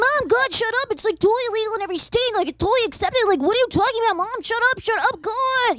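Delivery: put-on high, exasperated teenage whine; whiny teen son. Son brushing off his mother, high whiny exasperation. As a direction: roll your eyes at mom, high and whiny. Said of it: "he's like".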